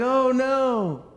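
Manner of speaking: high, loud mock fright; mock scared. Pastor voicing Dorothy's scared friends, hammed-up fright. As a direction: squeal it like frightened travelers. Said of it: "they're like".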